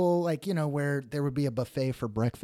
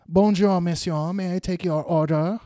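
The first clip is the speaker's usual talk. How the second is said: higher, formal French-waiter greeting; 4 semitones higher, 4 dB louder than usual. Acted. French waiter greets Ziggy at his table, mock-formal. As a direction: put on a French waiter's accent. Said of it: "And then the waiter comes up".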